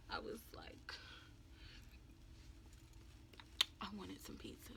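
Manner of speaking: whispered little confession; sheepish whisper. Retold or imitated. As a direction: admit it very softly, almost without voice. Small confession about ordering pizza.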